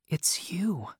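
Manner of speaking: soft, high, breath-only whisper; stunned whisper; hushed realisation. A narrator's reading. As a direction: whisper it as it dawns on you. Stunned recognition of secret correspondent.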